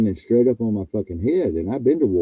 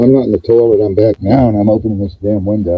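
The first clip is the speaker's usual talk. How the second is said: louder; 9 dB louder than usual. Real, not acted. Back at the mic, louder.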